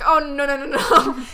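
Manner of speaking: loud outraged refusal with laughter; mock-yell a volley of refusals; retold yelling. Coworker refusing to be insulted by customer, mock yelling. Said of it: "I just hear her yelling".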